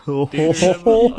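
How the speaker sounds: slow, high, loud mock-dramatic; parodying his own overly serious intro; mock dramatic echo. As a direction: stretch it out like a cheesy announcer. Mocking the host's intro line.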